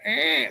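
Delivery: a single high cat mewl; a quick high cat squeak in the middle of the sentence; high distressed cat cry; imitated cat cry. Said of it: "still making that, ... sound".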